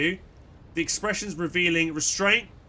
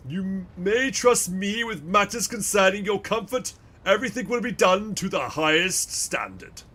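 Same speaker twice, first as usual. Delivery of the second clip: oily, self-important merchant voice; pompous fat man; 4 semitones higher than usual. Acted. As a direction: fawn pompously, very pleased with yourself. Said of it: "fat and pompous".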